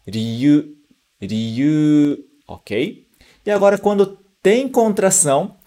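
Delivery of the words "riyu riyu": "Ryu, ryu" split ri-yu; teacher separating sounds in "Ryu"; syllables separated. Shown as a demonstration. Teacher drilling separate sounds: ri-yu. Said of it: "A gente separa os sons".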